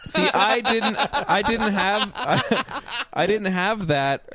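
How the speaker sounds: high voice, talking through giggles; laughing. Real, not acted. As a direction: struggle to get the words out while laughing. Laughing while trying to answer.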